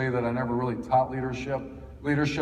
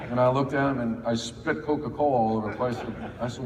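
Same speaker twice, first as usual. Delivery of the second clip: ordinary delivery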